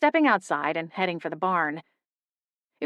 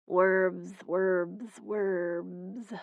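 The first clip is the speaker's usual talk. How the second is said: fading, softer sleepy mutter; 7 dB quieter, much slower than usual. Acted. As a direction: mumble it half asleep. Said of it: "came a soft, muttering voice".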